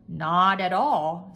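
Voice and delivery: slow, loud lordly refusal; loud, deliberate master's voice; pompous master. A narrator's reading. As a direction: correct her slowly and loudly. Master contradicts maid.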